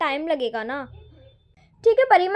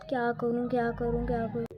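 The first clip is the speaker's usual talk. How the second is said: lower, soft helpless repetition; fretting to herself; 7 semitones lower, 10 dB quieter than usual. Acted. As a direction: lower and softer worried murmur. Bahu frets alone over sari.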